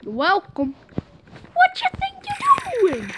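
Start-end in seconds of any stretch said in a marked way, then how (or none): 1.5-3.1 very high mock indignation; mock outrage. Real, not acted. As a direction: squeal in fake outrage.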